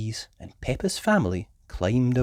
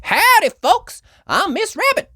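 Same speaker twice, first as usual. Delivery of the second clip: friendly diner cowgirl; Southern cowgirl; 6 dB louder than usual. Acted. Cowgirl Miss Rabbit.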